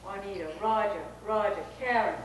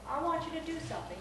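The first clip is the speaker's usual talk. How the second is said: put-on higher, quick God with a Southern accent; comic Southern voice of God; Southern-voiced God; 6 semitones higher, 4 dB quieter, faster than usual. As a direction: play God with a Southern lilt. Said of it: "Usually he talks in a southern voice".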